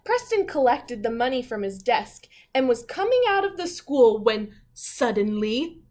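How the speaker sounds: storybook narration, building to suddenly; story reading. A narrator's reading. Teacher reading the class a story, with a suspenseful pause. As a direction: read it like a picture book for kids.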